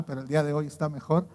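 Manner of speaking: usual speaking voice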